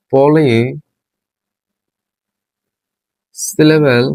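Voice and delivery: Polysyllable split in two, long pause after পলি; slow dictation. Shown as a demonstration. Teacher dictating the definition as it is written, slow dictation. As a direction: draw out পলি, wait, then give syllable.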